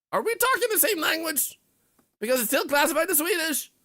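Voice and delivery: very high, loud mock outrage; baffled shouting. Baffled Swede reacting to an incomprehensible accent, high exasperated shout. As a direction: cry out in squeaky, exasperated disbelief.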